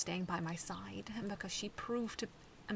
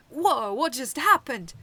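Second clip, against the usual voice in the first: loud, higher, slow confused cry; loud bewildered exclamation; blaring; 6 semitones higher, 16 dB louder, slower than usual. Acted. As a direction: blare out, waking up confused. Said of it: "Metabee's voice came blaring".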